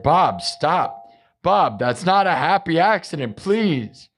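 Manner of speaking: high, pleading cries of please. Retold or imitated. Friend begging Bob Ross to stop, high exasperated pleading. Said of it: "he was like".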